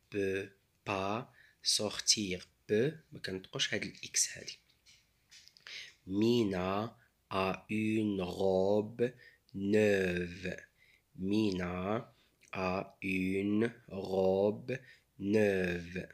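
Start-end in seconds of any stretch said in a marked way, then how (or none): none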